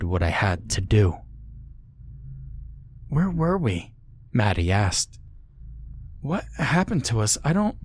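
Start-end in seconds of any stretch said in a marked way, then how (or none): 3.1-7.8 put-on high, soft, lost girl; dazed girl waking; confused daughter. A narrator's reading. Daughter waking in the car asks where they are, confused.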